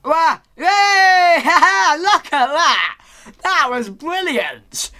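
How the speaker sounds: loud, high whoops and laughter; real elated shouting. Real, not acted. Player celebrating a brilliant move, elated shouting. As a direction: whoop in triumph at your own feat.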